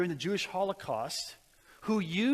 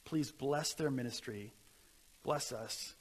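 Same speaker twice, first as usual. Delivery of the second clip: soft, steady pastoral prayer voice; earnest prayer; 5 semitones lower, 4 dB quieter than usual. Acted. Pastor leads the congregation in a closing prayer. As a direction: lift it up to God humbly.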